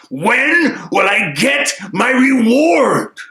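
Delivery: high, loud, very slow furious shout; angry shout. Shown as a demonstration. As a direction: yell it out in anger. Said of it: "Let's say it like we're angry".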